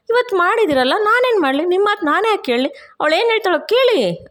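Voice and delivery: a fed-up mother throwing up her hands at everyone; exasperated outburst. Retold or imitated. Mother-in-law bursts out in family quarrel.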